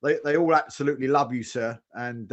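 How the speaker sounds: normal talking voice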